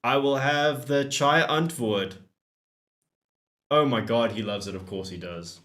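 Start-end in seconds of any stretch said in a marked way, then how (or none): none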